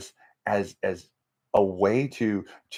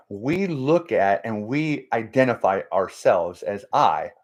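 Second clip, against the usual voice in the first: normal talking voice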